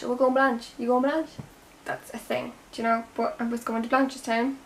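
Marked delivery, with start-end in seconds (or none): 0.0-1.3 casual Dublin chat. Shown as a demonstration. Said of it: "so we call it Blanche".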